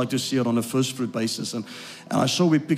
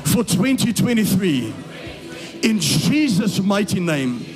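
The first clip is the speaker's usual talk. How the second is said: loud, high-pitched fiery preaching; preaching at full force; 6 semitones higher, 3 dB louder than usual. Acted. Pastor proclaiming the Lord's promise to the congregation, loud and fervent. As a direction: proclaim the promise like thunder over the crowd.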